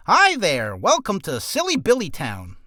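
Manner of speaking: high, loud welcome to a silly town; loud showy greeting. Shown as a demonstration. Voice actor doing a test recording welcome, loud and showy.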